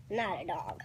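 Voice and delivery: hushed whisper after a giggle; whispering, amused; whispered aside. Real, not acted. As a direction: slip it in as a whispered side comment.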